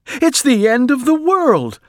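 very high, loud wail of doom; panicked shout. A narrator's reading. Missus Watson in the falling bed, panicked shout. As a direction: cry out as if disaster has struck. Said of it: "shouted Missus Watson".

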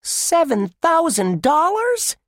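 high, slow amazed squeak. Acted. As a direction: squeak it in disbelief. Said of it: "Josh squeaked".

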